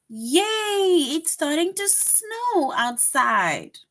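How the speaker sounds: high, happy, slow cheer; excited exclamation. Shown as a demonstration. Teacher reading the exclamation option with delight. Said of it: "That shows strong feelings".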